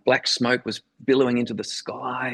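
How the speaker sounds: ordinary talk, nothing marked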